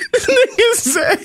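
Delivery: high, laughter-choked reading; struggling through laughter. Real, not acted. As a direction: keep reading though you are cracking up. Quoting post through laughter.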